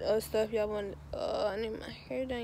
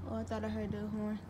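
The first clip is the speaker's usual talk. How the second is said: winded and breathing hard; 5 dB quieter than usual. Real, not acted. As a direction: talk while still catching your breath. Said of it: "I'm out of breath".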